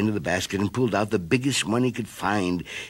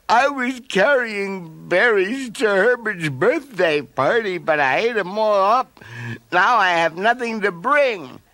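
higher, slow tearful plea; sobbing bear; 10 semitones higher, 4 dB louder, slower than usual. A narrator's reading. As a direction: confess between sobs. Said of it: "Between giant sobs".

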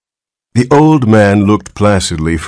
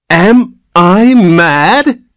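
high, slow enraged shout; thundering; 13 semitones higher, 6 dB louder, much slower than usual. Acted. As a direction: roar it out in disbelief. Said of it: "thundered Mister Button".

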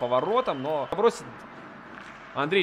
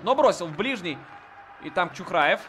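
usual speaking voice

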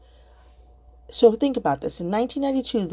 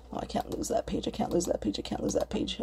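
hushed, anxious muttering at the computer; under her breath, worried about losing a tab; muttering to herself; 8 dB quieter than usual. Real, not acted. Vlogger fretting at her laptop, hushed and anxious.

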